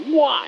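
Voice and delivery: squeaky high-pitched "Wah!"; mock whoosh. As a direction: voice the nose jerking up. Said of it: "the nose went".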